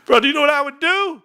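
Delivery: high-pitched, worked-up question; excited disbelief. Real, not acted. Streamer reacting to a cashier's wage, excited disbelief. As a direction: burst out high, amazed at the thought.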